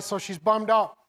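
ordinary talk

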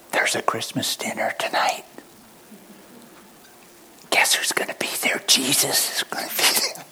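stage whisper, secretive; whisper yelling. Pretend. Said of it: "Why are we whispering?".

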